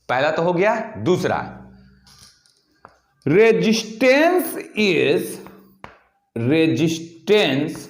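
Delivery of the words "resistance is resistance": each word drawn out for writing down; slow dictation. Shown as a demonstration. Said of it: "तो देखिए".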